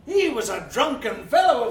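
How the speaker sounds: ordinary talk, nothing marked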